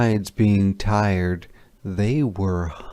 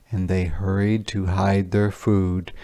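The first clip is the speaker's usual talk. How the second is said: usual speaking voice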